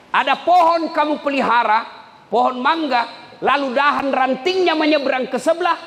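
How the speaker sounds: fiery sermon voice; preaching. Acted. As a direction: drive the example home like a preacher. Preaching at full force.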